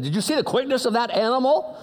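playful fan's disbelief; amazed fan. Retold or imitated. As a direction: butt in, marveling at the player. Butting in excitedly about the player.

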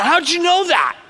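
high-pitched startled outburst, acted; imitating freaked-out shock. Gal to the man baptizing her, acted freak-out. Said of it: "got freaked out".

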